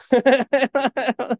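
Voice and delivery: high burst of real laughter; real laugh at dad's retort; hearty laugh. Real, not acted.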